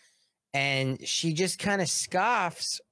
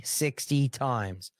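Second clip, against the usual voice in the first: normal talking voice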